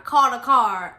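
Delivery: loud, insistent order; imitating a dad's curt insistence; with attitude. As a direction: repeat the order with impatient attitude. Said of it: "He texted me like an attitude".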